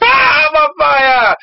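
high, drawn-out comic cry; mock panic. Demon yells that he is burning, mock panic. Acting demon set on fire.

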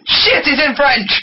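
high, slow mock panic; mock alarm. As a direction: stretch it out in fake alarm. Repeating line with high slow mock.

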